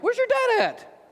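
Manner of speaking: very high, drawn-out puzzled question; mock confusion. Husband confused by wife saying Dad, high mock-baffled voice. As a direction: squeak it out in exaggerated bafflement.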